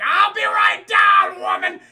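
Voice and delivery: high, drawn-out mock bellow; aggressive yell. Husband answering his wife's call to dinner, aggressive mock shout. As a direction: yell it hard across the house, hammed up. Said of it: "He's so aggressive".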